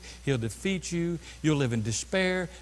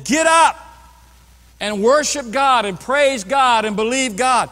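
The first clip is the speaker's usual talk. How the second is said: rousing shout; 5 semitones higher, 8 dB louder, slower than usual. Acted. Rallying call to worship.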